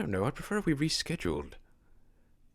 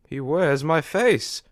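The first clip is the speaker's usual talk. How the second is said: slow and louder, stunned with fear; horrified; 9 dB louder, slower than usual. Acted. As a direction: spell out each word in disbelief. Sees monster with his face.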